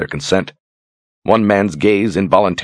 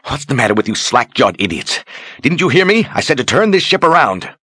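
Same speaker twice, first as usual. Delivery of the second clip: angry rebuke; 8 semitones higher than usual. Acted. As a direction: scold them, climbing up to a shriek. Sorceress berates gawking sailors.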